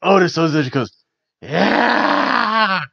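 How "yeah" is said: imitated wrestler's yell. Recapping a wrestler's reaction to the drink, mock rasping yell. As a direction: hold a rough, higher yell.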